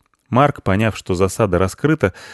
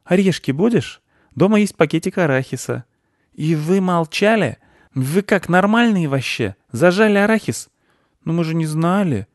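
high-pitched indignant chatter; bickering figures; 10 semitones higher than usual. Acted. Boys and squirrel argue over peanuts, high-voiced. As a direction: keep it high and childlike.

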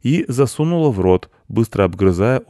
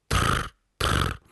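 two short rolled trills; beetle trill; 12 semitones lower, 4 dB quieter than usual. Acted. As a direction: buzz twice like an insect. Beetle answers no.

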